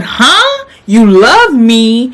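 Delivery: stunned and disbelieving, drawing it out; shocked disbelief. Retold or imitated. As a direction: caught off guard by a love confession. Shocked at his confession.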